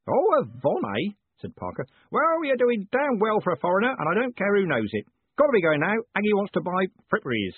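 high, loud, bluff character voice; hearty Parker. Acted.

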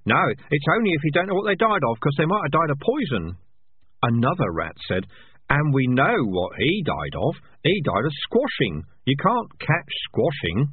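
a small rat setting the others straight, lightly. Acted. Rat explains poison.

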